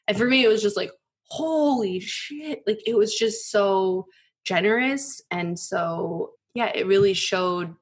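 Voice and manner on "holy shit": high, very drawn-out amazement; stunned amazement. Retold or imitated. She relives being offered money for her attorney, stunned amazement. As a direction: stretch it out slowly, high with awe. Reacting to an unexpectedly generous offer.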